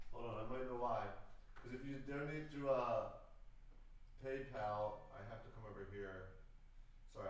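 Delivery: talking off-mic while fixing donations; away from mic. Real, not acted. To chat while checking PayPal, away from the mic.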